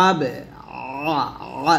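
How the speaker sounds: আবে with a squeezed throaty first vowel; pressed ayn. Shown as a demonstration. Teacher showing how ayn sounds, pressed throat. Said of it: "আইনটা আমরা কিন্তু একটু চাপ দিব".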